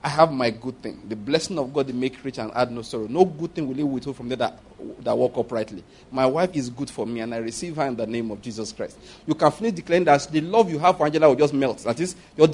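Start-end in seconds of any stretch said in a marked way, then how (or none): 0.0-9.0 low, soft prayerful declaration; quiet prayer. Retold or imitated. Preacher voicing a man's quiet declaration over his marriage. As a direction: pray it softly, with conviction. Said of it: "a very simple word for that".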